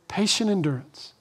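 hushed echo of "Patient endurance"; soft repeat. Shown as a demonstration. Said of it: "repeated softly".

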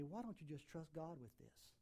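soft inner voice of a subtle thought. Retold or imitated. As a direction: let it drift in gently, almost unheard. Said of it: "one of those subtle thoughts".

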